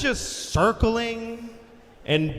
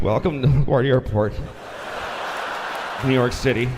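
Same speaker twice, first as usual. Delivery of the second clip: winded, low cabin announcement; low announcement broken by gasping pauses; out of breath pilot; 8 semitones lower, faster than usual. Retold or imitated. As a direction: imitate a spent pilot addressing passengers. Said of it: "he's totally out of breath".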